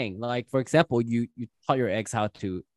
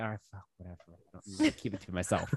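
quiet; 5 semitones lower, 13 dB quieter, faster than usual. Retold or imitated. Quoting own resigned inner thought.

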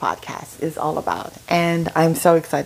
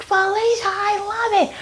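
mock delighted scream. Girlfriends loving the cussing answer, high imitated squeal. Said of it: "they're all screaming".

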